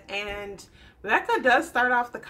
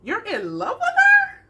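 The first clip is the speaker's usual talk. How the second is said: high, incredulous squeal as a mom; hammed-up shock, jumping loud at the end; taken aback; 10 dB louder than usual. Mom reacting to her son being in love, hammed-up disbelief. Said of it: "taken aback by this news".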